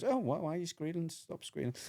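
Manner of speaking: high, soft puzzled voice; mock bewildered dog. Voicing dog puzzled by squealing.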